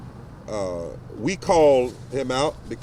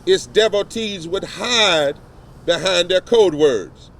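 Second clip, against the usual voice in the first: rising oratory, paced and emphatic, a touch louder; formal speech; 3 semitones higher, 3 dB louder than usual. Acted. Reverend reading an open letter to the press, in formal oratory. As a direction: preach the letter like a pulpit address.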